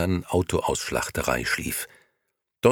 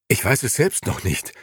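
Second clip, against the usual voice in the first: panting; 5 semitones higher than usual. A narrator's reading. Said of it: "keuchte Beppo".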